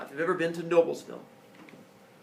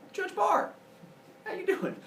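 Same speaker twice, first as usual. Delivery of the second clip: bright, much higher, cheerful hello; cheery greeting; 3 dB louder than usual. Retold or imitated. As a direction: greet an old acquaintance with a big surprised smile. Greeting the judge at wedding.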